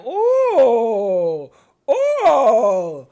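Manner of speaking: loud, drawn-out shocked ooh; loud gossip ooh; scandalized ooh. Real, not acted. Reacting to juicy post.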